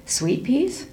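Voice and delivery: "Sweet peas?" asked with a rising tune, mildly surprised; mother echoing her son's words, unsure; puzzled question. Acted. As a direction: echo it back as a doubtful question. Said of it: "I said again".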